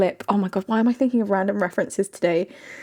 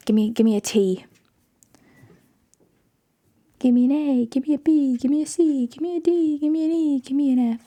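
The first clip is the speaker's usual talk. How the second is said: camp counselor cheer; 4 semitones higher than usual. Acted. Chanting letters like a cheer.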